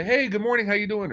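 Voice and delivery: high-pitched good-morning greeting on a call; acted friendly greeting. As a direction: greet them like a regular, pitched well up. Acting his call to the restaurant.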